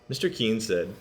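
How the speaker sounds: usual speaking voice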